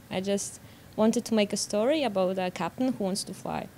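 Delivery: ordinary talk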